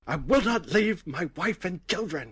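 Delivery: loud, high-pitched imitation of an indignant meltdown; mock angry outburst. Co-host joins in imitating the angry bath scene, mock outraged. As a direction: blurt it out like a hammed-up movie tantrum. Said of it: "getting really angry about".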